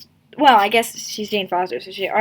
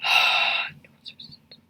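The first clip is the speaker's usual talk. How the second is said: sighing whisper; 6 dB quieter than usual. Real, not acted. Exasperated sigh.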